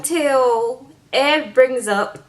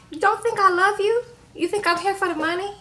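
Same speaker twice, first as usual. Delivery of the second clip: high, softish pleading retelling; mock-hurt questions, pitched well up; imitated protest; 8 semitones higher than usual. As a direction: protest innocence in a raised, imitated voice. Acting Rose protesting her love.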